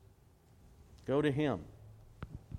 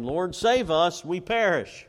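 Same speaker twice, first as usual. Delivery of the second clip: raised, drawn-out cry to the Lord; calling out for rescue; 5 semitones higher, 8 dB louder, slower than usual. Retold or imitated. Disciples call to Jesus in the storm, calling out. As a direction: call out louder and higher for help. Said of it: "They called out".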